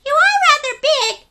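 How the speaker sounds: high, loud curious little penguin voice; a tiny penguin full of questions. Acted. Story reader voicing small penguin Pipkin asking big questions. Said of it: "said Pipkin".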